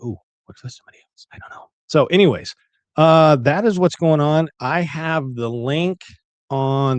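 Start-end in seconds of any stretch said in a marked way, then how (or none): 0.0-1.7 whispered inner thought. Retold or imitated. Retold panicked inner thought.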